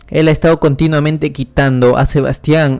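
plain reading voice

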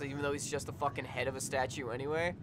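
usual speaking voice

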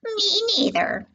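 tiny, high little voice; high-pitched echo of a parent; young kangaroo. A narrator's reading. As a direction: pipe up like a little joey. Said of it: "the young kangaroo in her pouch said".

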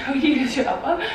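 amused, quick, questioning herself; laughing question. Real, not acted. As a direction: laugh at your own words.

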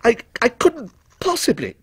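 flustered protest. A narrator's reading. Customer forestalling the salesman's testimony, flustered. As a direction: stumble over a high-pitched refusal. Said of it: "cried Timothy, forestalling almost rudely".

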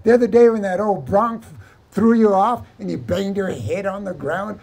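high, loud wail of mock amusement; mocking hilarity. Vaqueros teasing the man who says he's rich, mocking hilarity. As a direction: whoop it out as if laughed to tears. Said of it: "all the vaqueros started laughing hard".

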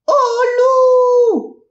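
piercing high cry of wolf; high shrieking cry. Acted. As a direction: shriek it like a child crying wolf. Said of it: "hurla alors le petit lapin". (cri perçant et aigu)

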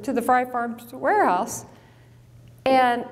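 normal talking voice

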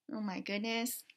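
ordinary delivery

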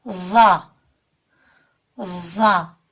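"Za" with a light lisp, tongue tip between the teeth; slightly lisped za. Shown as a demonstration. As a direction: lisp "za" gently. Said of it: "Za da hafif peltek okunur". (hafif peltek "za")

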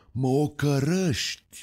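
affronted outburst of an angry saint; a hint of an offended saint; mild indignant cry. A narrator's reading. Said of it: "strigă el".

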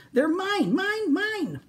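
high greedy dino cry; greedy Minosaur. Acted. Minosaur claims the blocks after knocking the tower, high. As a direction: grab the blocks and claim them.

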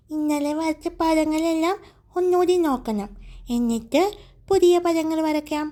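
a hint of an eager little child explaining his plan; little boy Kannan. A narrator's reading. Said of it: "Kannan പറഞ്ഞു".

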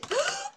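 high startled gasp; sharp gasp. Real, not acted. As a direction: gasp in sudden fright. Fast in den Tod gestürzt.

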